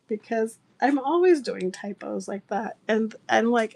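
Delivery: ordinary talk, nothing marked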